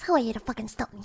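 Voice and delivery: normal talking voice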